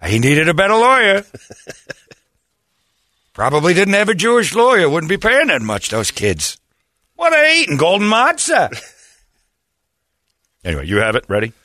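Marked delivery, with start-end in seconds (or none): 0.1-1.2 put-on high, loud Yiddish accent. 3.3-8.7 put-on high, loud Yiddish accent. Continues Yiddish bit.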